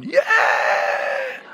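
very high, drawn-out squeal of joy; God cheering. Acted. Preacher voicing God celebrating the gift, high gleeful cheer. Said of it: "and God's going".

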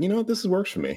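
high, lightly acted voice; pitched well up, pleased with the find; contented character. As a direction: play a character cheerfully settling for it. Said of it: "he's like".

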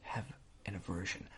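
creepy whisper. Pretend. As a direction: breathe it like a secret obsession. Obsession whispered at potato-hater.

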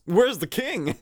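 put-on high, eager boy barging in with "Where's the king?"; blustering Sora. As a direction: burst in like an impatient kid hero.

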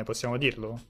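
dropped low and soft under the breath; muttered aside. Real, not acted. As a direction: throw it away quietly, almost to yourself.

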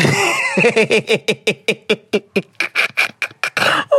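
real amused laughter; long laugh. Real, not acted. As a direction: burst out laughing at the slip. Laughs at own slip on polygamy.

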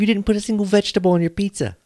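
mocking high voice. Arguing about vegetables on pizza.

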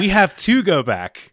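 mock-epic cry of the island; dramatic catchphrase. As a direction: proclaim it like a desperate castaway.

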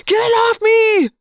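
high, loud frantic plea, falling; panicked screaming. Acted. Boy begging to remove the rat.

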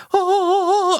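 high, awestruck gasp of wonder; like angels just sang; awed oh. Retold or imitated. Finding the perfect forum, high awed oh.

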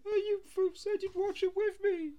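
put-on high, whiny childish plea; mock a kid begging to watch together. Roommate mimicked as a whining child. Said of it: "he's like".

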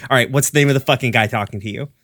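fast, impatient question; quick demand. Acted. Dante pressing Benny for the name, fast. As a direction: rattle it off in a rush. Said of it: "Dante goes is like".

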